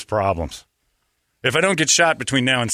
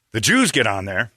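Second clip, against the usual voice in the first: normal talking voice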